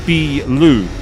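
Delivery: "bilu" with the first syllable drawn out; long vowel. Shown as a demonstration. Teacher contrasting beer with building, long vowel. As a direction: stretch the "bi" of "bilu" long, then "lu". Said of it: "You extend the B sound".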